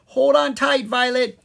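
high, loud urgent call; cried out. Acted. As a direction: shout a warning to hold on. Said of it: "he cried".

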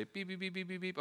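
high, soft mock timer beeps; timer beeping. Pastor imitates patience's timer running out. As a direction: chirp like a stopwatch alarm. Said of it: "I can't say".

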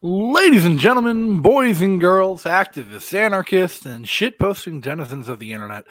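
big, loud ringmaster announcing, rising pitch; grand entrance announcement. Acted. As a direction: call it out like a ring announcer. Host's grand show opening.

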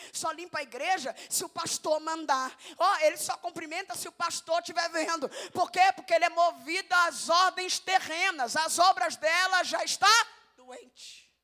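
loud, rising sermon cry; preaching at full force. Real, not acted. Preacher crying out to the church about true service, loud preaching. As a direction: preach it out hard to the congregation.

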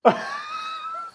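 high squealing burst of laughter; a sudden high laughing shriek; real squeal of laughter. Real, not acted. Burst of laughter at Michael Bay.

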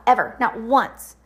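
ordinary talk

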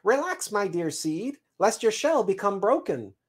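high, lilting squirrel voice; reassuring squirrel messenger. Acted. Squirrel brings the seed word from the roots, reassuring chirp. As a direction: soothe and announce in a high chirp.

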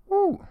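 loud, high-pitched "woo"; high whoop. Real, not acted. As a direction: let out a high whoop after a hit. Reacting to a vape hit.

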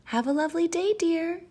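high, singsong motherly send-off; acted storybook mother; cheery mom. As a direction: wave the child off in a high voice. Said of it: "said his mom".

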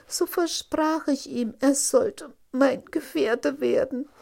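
high, loud princess pouring out her story; distressed princess. Acted. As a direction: confess to father, upset and high-pitched. (aufgelöste Prinzessin, hoch und laut)

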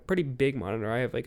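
ordinary talk

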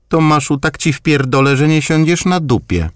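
high grandmother's voice, mock threat; threatening grandma. A narrator's reading. Grandmother threatens her grandson Tomasz, voiced high. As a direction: threaten him half in jest.

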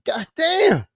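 high-pitched, drawn mock amazement; shocked exclamation. James seeing the evidence on Koch, shocked exclamation. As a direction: retell his stunned reaction, pitch jumping up.

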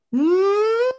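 squeaky burst of laughter; high laugh. Real, not acted. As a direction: let out a high cackle. Laughing at own story.

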